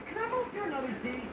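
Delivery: ordinary delivery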